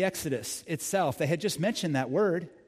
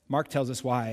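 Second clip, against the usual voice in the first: usual speaking voice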